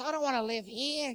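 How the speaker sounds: high-pitched mock wifely complaint; objecting wife. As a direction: refuse in a put-on high voice. Wife objects to run-down house.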